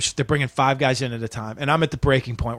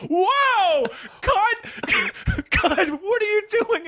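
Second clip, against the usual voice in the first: much higher, laughing yells; shocked shouting director; much slower than usual. Retold or imitated. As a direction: stop the take in alarm. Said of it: "and they're like".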